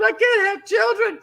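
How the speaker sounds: blurting it out through sobs; crying so hard. Retold or imitated. Wife to stranger at stockyard, high tearful cry. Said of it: "And I started crying so hard".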